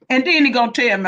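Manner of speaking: ordinary talk, nothing marked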